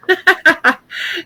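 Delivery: high pained laugh; laughing through pain. Real, not acted. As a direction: laugh that hurts. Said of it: "oh, God, it hurts to laugh".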